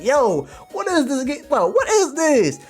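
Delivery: high, loud, squealed questioning; shocked disbelief. Retold or imitated. Streamer confronted by a manga twist, squealing in mock shock.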